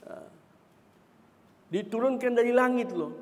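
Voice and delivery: ordinary talk, nothing marked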